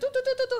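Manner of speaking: quick high-pitched "tu-tu-tu" noise; imitated shattering. Mother showing herself breaking into pieces, imitated noise. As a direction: patter out little high breaking sounds.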